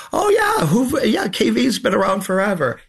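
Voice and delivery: high-pitched mock realization, easing down; sudden recognition. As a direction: exclaim it like people suddenly remembering someone. Voices people's reaction to the book.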